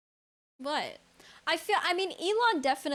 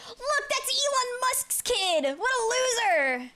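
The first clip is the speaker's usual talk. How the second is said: high, slow mocking jeer; mocking bully voice; 12 semitones higher, 4 dB louder, slower than usual. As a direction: taunt like a schoolyard bully. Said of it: "Unless people are like".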